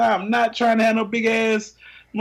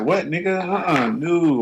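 ordinary talk

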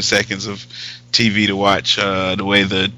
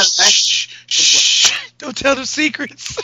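mock-secretive hush, high-pitched; playful shushing; 13 semitones higher, 3 dB louder, slower than usual. Jokingly hushing co-host about secrets.

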